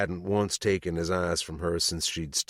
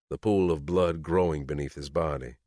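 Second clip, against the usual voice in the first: ordinary delivery, nothing marked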